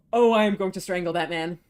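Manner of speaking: loud half-joking threat; mock furious outburst. Real, not acted. Player fuming loudly at abusive game character. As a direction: blow up at the villain, half in jest.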